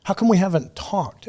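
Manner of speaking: high mock-whiny nagging; whiny complaint. Mocking a bitter friend's whiny complaint. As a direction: imitate a clingy friend whining.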